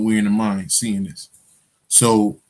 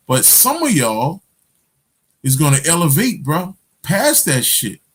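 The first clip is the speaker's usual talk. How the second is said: preaching; 7 semitones higher than usual. Acted. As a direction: preach it like a street prophet. Raised voice, preaching about transcendence.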